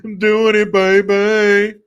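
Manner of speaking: loud, high, hyped up; loud hyped-up cheer; excited. Real, not acted. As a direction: celebrate like the beat just dropped. Excited dancing to song.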